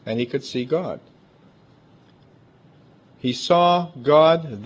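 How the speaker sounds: ordinary talk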